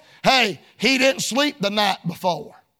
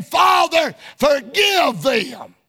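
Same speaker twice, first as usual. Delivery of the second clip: crying out; 3 semitones higher, slower than usual. Retold or imitated. Preacher voicing Jesus' plea.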